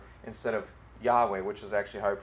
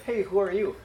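high-pitched casual call to a stranger; curious stranger; 8 semitones higher than usual. Pretend. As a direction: toss out a playful, pitched-up question. Imagined stranger asking his name.

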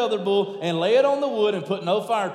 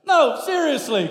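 usual speaking voice

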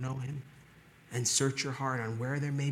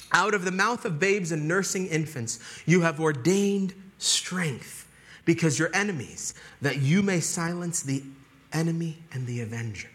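loud, higher proclamation of a verse; forceful scripture reading; 4 semitones higher, 5 dB louder than usual. Real, not acted. Preacher proclaiming a psalm to the congregation, forceful preaching.

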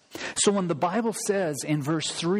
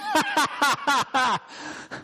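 high jeering cackle; sneering crowd laugh; mocking laugh; 10 semitones higher than usual. Shown as a demonstration. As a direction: laugh him to scorn.

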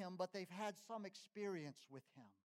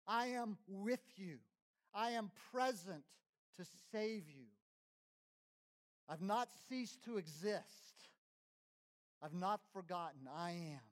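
God's reassuring promise; 2 semitones higher than usual. Acted. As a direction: speak as God promising presence. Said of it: "he is here".

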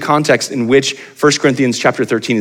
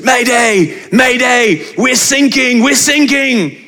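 much higher, urgent distress call over the radio; panicked British sailors; 13 semitones higher, 4 dB louder, much slower than usual. Retold or imitated. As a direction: cry mayday like a sinking sailor. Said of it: "these British sailors".

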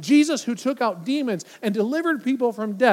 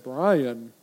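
eerie voice; 8 semitones lower than usual. Acted. Imagined mysterious voice calling name.

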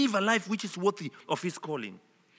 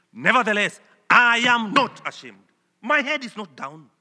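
loud, forceful stress on NEVERTHELESS and NOT ashamed; emphatic; 2 semitones higher, 8 dB louder than usual. Shown as a demonstration. Preacher showing how emphatic Paul's word is, emphatic. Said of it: "Greek word for it is emphatic".

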